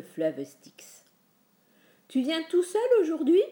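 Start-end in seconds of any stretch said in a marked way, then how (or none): 2.1-3.4 high, loud grumbled question; grumbling. A narrator's reading. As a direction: grumble it in a raised voice. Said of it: "bougonna Charon". (question bougonne, voix montée)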